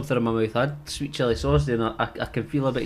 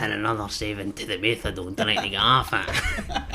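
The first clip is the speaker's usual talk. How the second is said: lightly voiced character; 4 semitones lower than usual. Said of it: "You're better at it than me".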